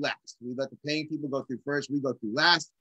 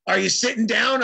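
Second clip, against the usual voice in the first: louder, higher portentous question; Paul Siegel; 5 semitones higher, 6 dB louder than usual. Retold or imitated. Friend preparing the drummer for big news, portentous. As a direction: imitate a friend setting up momentous news. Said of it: "this is how he said it".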